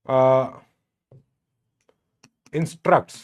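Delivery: slow word-by-word dictation with long pauses. Real, not acted. Teacher dictating the constitution point to students, measured dictation. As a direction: spell it out piece by piece for note-takers.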